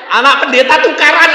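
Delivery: loud, laughing mock scold. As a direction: laugh while scolding the pastor's kid. (teguran pura-pura sambil tertawa keras)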